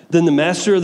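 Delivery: ordinary delivery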